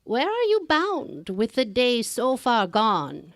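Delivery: put-on a kindly but eerie old crone, drawn out; old woman in green.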